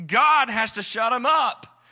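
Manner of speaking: ordinary delivery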